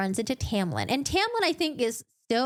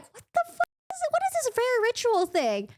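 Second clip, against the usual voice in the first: much higher, fast disbelieving cry; shocked outburst; 13 semitones higher than usual. Retold or imitated. Feyre hearing about the ritual: high shocked outburst. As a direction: blurt it in shock. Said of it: "And so Feyre's like".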